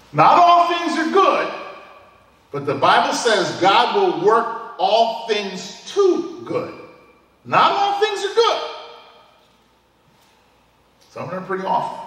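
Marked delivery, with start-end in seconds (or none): 0.1-1.5 high, loud, slow with weight on all; emphatic preaching. Shown as a demonstration. Preacher insists to the congregation that not all things are good, emphatic. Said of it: "preacher stresses the point". 7.4-8.6 high ringing repeat, stress on all; emphatic repeat. Shown as a demonstration. Preacher repeats to the congregation that not all things are good, ringing emphasis. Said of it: "repeats the point higher".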